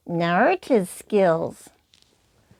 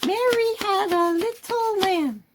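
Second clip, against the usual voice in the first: puppet voice; 10 semitones higher than usual. Shown as a demonstration. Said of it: "to make it look more realistic".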